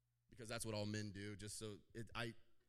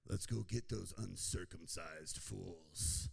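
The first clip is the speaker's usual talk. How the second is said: lower, slow taunting challenge; mock bravado; trash talk; 7 semitones lower, 4 dB louder, much slower than usual. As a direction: taunt the enemy. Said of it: "which is trash talk".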